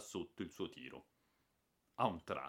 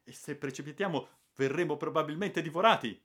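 higher, louder fearful cry; mild terrified reply; 7 semitones higher, 7 dB louder, faster than usual. Acted. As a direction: answer shaking with fright. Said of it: "invaso dal terrore".

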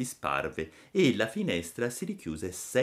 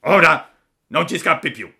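loud, raised villain's threat; menacing ugly voice; 8 semitones higher, 11 dB louder than usual. A narrator's reading. Assassins seize the puppet, menacing villain voice. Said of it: "due vociacce gli brontolarono minacciosamente".

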